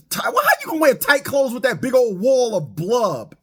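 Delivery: mock outraged shout; incredulous shout. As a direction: yell it in disbelief. Shouting disbelief at the crowd.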